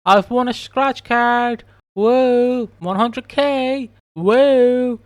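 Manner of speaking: high, slow, hammed-up cheering; mock excitement. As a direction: squeal the win like a fake jackpot winner. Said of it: "It's like".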